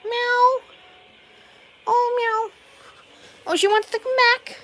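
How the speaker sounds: very high cooing meow and pet talk; mock meow. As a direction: coo at the cat in its own language. Meowing and cooing at cat.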